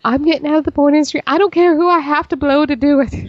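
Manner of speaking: high, fast quoted stripper; stripper quote. Retold or imitated. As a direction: rattle it off in a high voice. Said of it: "She's like".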